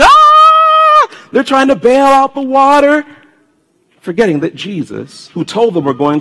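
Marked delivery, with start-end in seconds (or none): 0.0-1.1 high, loud, frightened shriek; scared disciples in the storm, a shrill scream; mock panicked scream. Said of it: "The disciples are screaming".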